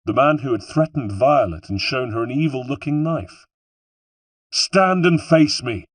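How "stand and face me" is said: slow, high, loud command; commanding shout, drawn out; shouted challenge. A narrator's reading. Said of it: "I shouted".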